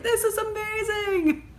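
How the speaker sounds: high, slow, squealing delight; gushing excitement. Retold or imitated. Vlogger recalling her delight at the cake koozies, squealing high. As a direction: gush over the yarn cakes like a fan. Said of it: "and I was like".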